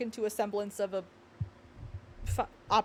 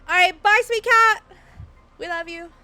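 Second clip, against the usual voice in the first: fond goodbye; 7 semitones higher, 12 dB louder than usual. Real, not acted. Farewell to leaving viewer.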